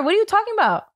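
incredulous. Real, not acted. Disbelief at friend's claim.

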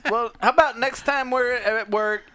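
ordinary talk, nothing marked

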